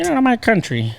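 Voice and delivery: high mocking nativist yell; mock Trump supporter. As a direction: parody an angry nativist. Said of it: "You sound like a Trump supporter".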